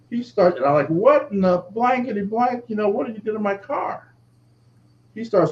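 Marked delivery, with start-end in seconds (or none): 0.9-4.1 high, pitched-up outrage at the vandal; retelling a shocked cry after his car was smashed; indignant outburst. Retold or imitated. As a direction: yell it like you can't believe what he did to your car. Angry yell at car vandal.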